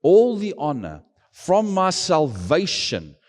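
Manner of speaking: slow, swelling preacher's call; closing a sermon, preaching slowly with full force. Acted. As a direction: drive each phrase home from the pulpit.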